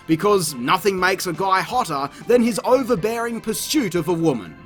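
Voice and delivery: ordinary delivery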